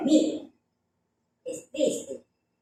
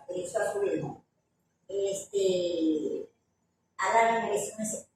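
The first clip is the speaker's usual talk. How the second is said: usual speaking voice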